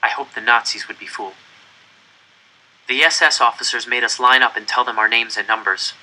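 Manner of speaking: usual speaking voice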